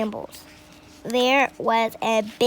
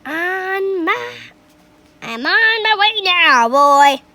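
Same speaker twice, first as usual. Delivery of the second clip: put-on high, loud old woman calling; 7 semitones higher, 7 dB louder than usual. A narrator's reading. Grandma calling down to George as she rises. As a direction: call down from above. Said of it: "she called down to George".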